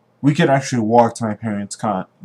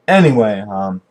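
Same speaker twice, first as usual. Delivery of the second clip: ordinary talk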